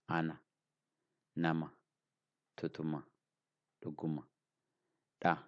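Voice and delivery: Ana, Nama, Tutuma, Luguma, Da in short, light syllables with single consonants; short and soft. Shown as a demonstration. Teacher listing example words for learners, short and soft. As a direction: read each word short and soft, separately. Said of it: "we call them short".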